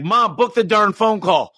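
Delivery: high, exasperated plea; impatient son. Retold or imitated. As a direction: hurry her up, fed up. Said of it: "I'd be like".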